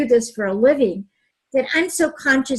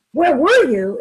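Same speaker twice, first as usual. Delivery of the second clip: louder, sharp accusing question; accusing demand; 3 semitones higher, 5 dB louder than usual. Shown as a demonstration. Partner home late, demo of the harsh version, loud and accusing. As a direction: snap it out as a reproach. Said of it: "Instead of saying".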